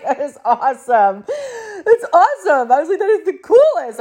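thrilled mom cheering her son on; excited praise. Retold or imitated. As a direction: gush over your kid's answer. Mom thrilled at son's answer.

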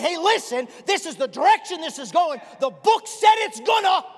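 shouted warning. Retold or imitated. Old Bible men warning the church, shouting. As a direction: cry it out like a prophet.

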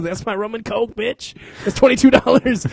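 high, mock-indignant retort; sassy defiance. Telling his cohost he drank the ashy rum anyway, sassy. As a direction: defiantly claim the drink. Defiant drunk quote, laughing.

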